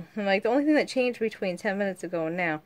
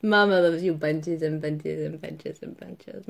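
slow, lower loving croon; tender cooing; 5 semitones lower, slower than usual. Real, not acted. Mother cooing to her daughter, slow and tender.

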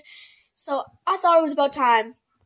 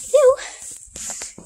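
high calling voice; 12 semitones higher than usual. Real, not acted. Calling her pony over.

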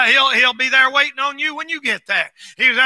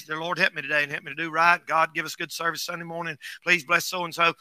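quiet prayer; 7 semitones lower, 8 dB quieter than usual. Retold or imitated. Preacher voices his silent prayer.

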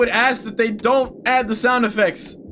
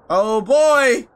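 ordinary delivery

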